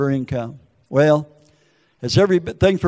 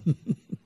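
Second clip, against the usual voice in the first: short, quiet mock chuckle; imitated laugh; 6 dB quieter than usual. Imitating Timothy McVeigh going to court, mock chuckle. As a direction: imitate a soft snickering laugh. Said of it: "he was laughing".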